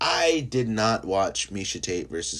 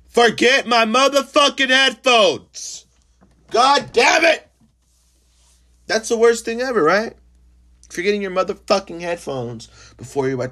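much higher, much louder exasperated yelling; outraged yell; 12 dB louder than usual. Real, not acted. Complaining to listeners about forgotten headphones, outraged yell. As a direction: blow up over the headphones.

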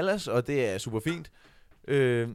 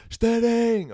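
high, loud crowd chant of a surname; shouting; 8 semitones higher, 5 dB louder than usual. Retold or imitated. Audience calling to their friend on stage, shouted. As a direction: holler the name from the floor. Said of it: "folk, der råber".

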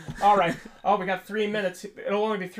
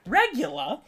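higher, rising Australian "regular"; Australian accent; 6 semitones higher, 4 dB louder, slower than usual. Retold or imitated. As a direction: mimic the Australian machine voice. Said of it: "it's an Australian voice".

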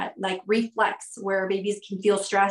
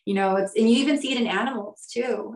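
ordinary talk

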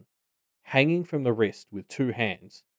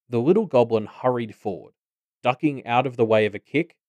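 plain reading voice

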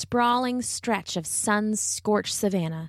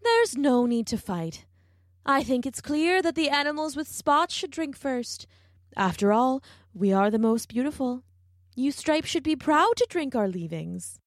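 high and haughty giraffe; lofty giraffe voice; 5 semitones higher than usual. A narrator's reading. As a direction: look down your long neck. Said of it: "looking down on all the other animals".

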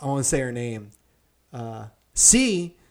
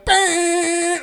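high loud burst; excited exclamation; 14 semitones higher, 6 dB louder than usual. Retold or imitated. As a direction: punch it like a gear shift. Gunning the car.

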